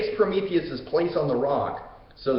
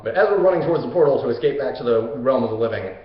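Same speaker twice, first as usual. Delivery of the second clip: usual speaking voice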